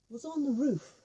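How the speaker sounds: usual speaking voice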